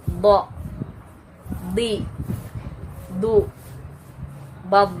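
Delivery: heavy full-mouth bo di du bab; isti'la demo, loud and thick; thick heavy letter demo. Shown as a demonstration. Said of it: "pangkal lidahnya harus diangkat".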